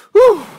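high, loud exhale of relief; relieved whew. Acted. Preacher ending the angry act, relieved whew. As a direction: blow out the tension. Blowing off the acted anger.

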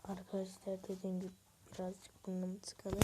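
usual speaking voice

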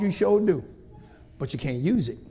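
ordinary talk, nothing marked

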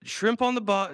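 high, hammed-up Australian accent. Host trying a British accent but slipping into a high mock Australian accent. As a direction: fumble into a cartoon Aussie in an Australian accent. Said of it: "that's Australian".

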